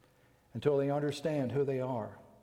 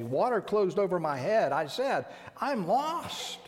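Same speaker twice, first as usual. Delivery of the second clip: much higher and louder, climbing to a cry on lost; impassioned scripture lament; 4 semitones higher, 6 dB louder than usual. Acted. Preacher voicing Jeremiah's despair to his congregation, crying out loud. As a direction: build the lament to a desperate peak.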